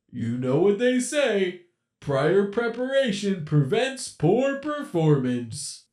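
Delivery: a playful, menacing animatronic captor; sinister animatronic voice. Acted. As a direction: toy with your trapped prey. Captor prepares the blade.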